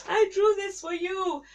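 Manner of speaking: put-on high, excited little child. Child showing mom and dad a drawing, high and eager. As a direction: play a small kid proudly showing a picture.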